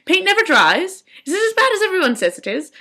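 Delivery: high, hammed-up mock posh quip; lightly voiced director; witty old director. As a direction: tease them with a pun. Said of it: "he was like".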